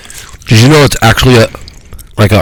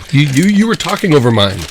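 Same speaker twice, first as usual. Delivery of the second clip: indignant complaint; 6 semitones higher, 5 dB quieter than usual. Real, not acted. Complaining about being talked over.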